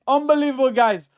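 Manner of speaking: excited yell. Real, not acted. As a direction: burst out "Unbelievable" to the chat.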